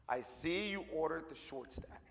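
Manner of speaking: lightly voiced waiter, low and slow; waiter's deadpan jab. Waiter teasing a dad about short stack, lightly voiced deadpan.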